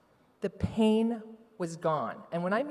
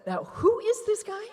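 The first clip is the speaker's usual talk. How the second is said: husband; 14 semitones higher than usual. Retold or imitated. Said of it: "He said".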